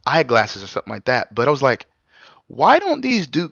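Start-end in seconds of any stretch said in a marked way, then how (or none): 2.5-3.5 high, drawn-out baffled question; retold incredulous reaction, slow and high-pitched; retold bafflement. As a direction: stretch it out high in mock disbelief.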